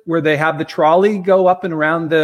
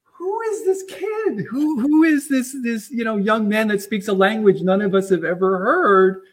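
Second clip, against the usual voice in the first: imitated amazement; 7 semitones higher than usual. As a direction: act out a whole crowd marvelling. Acting out amazed trolley passengers' reaction.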